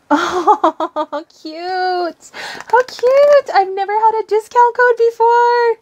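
high-pitched squeal of delight; thrilled squeal. Real, not acted. Thrilled by surprise discount code.

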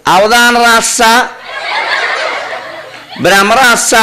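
రాస్స high and drawn out, the ksha lost; mock mispronunciation. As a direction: imitate someone saying రాస్స for rakshasa. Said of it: "రాక్షస పలకడం చేతనైతే కదా".

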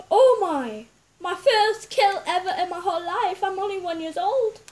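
loud, laughing delight; real excited laughing cheer. Real, not acted. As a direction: celebrate the first kill. First kill celebration.

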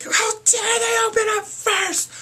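very high, slow, loud shrieking complaint; mock outraged friend. Friend shrieking that the group opened first. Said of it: "my friend was like".

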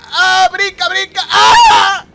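high, loud urgent shouts; real frantic gamer yell. Real, not acted. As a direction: yell at yourself to jump. Frantic jump in game.

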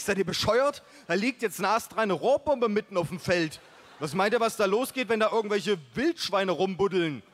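high, loud furious rant; outraged yelling. Acted. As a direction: shout them down in disbelief. Outraged at the buried bomb. (empörtes, lautes Schimpfen)

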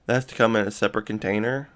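normal talking voice

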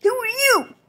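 squeaky high character voice; high puppet voice. Acted. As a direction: pitch it way up like a toy.

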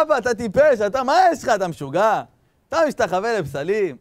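loud, high-pitched acted scolding; impassioned mock outrage; berating. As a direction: tell your father off in exasperation. Acted son berating his father.

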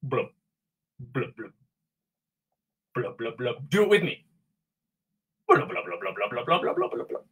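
soft bubbly blub sounds; fish blubbing. Acted. Voicing Lucky the fish's blubs. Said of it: "they liked to say".

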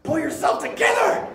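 high, loud self-command; inner pep talk. Retold or imitated. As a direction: shout yourself into shape. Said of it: "something in me thought".

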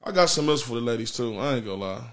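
usual speaking voice

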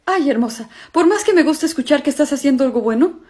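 ordinary talk, nothing marked

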